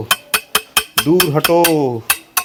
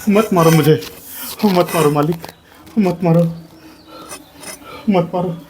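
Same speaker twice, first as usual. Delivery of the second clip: high, rising cries of don't beat me; desperate begging; 7 semitones higher than usual. Acted. Beaten man begs his master to stop hitting him, desperate high pleading. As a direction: plead for mercy as the blows come.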